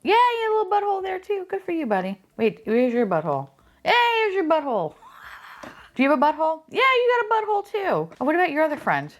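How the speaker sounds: high, fast cooing voice. Real, not acted. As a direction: coo at the little figures like pets. Baby-talk to the tiny figures.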